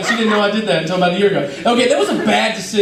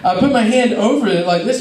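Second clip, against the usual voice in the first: normal talking voice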